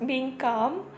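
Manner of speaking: soft, unhurried soothing talk, like leading a meditation; calming spiritual talk. Real, not acted. Speaker guiding viewers into stillness, soft meditative voice. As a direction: ease the listener into calm, gently.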